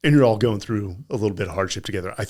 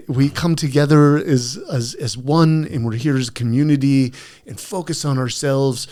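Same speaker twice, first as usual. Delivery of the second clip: eloquent speech; 4 semitones higher, 6 dB louder than usual. Retold or imitated. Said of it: "he does it very eloquent".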